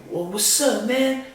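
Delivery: high-pitched mock taunt; confrontational challenge. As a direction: imitate a stranger squaring up, voice high. Retold stranger confronting, drawing gun.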